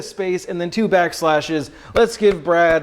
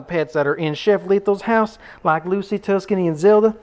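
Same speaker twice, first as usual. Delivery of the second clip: put-on Southern accent. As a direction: play a folksy North Carolina Southerner. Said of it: "this is the second accent".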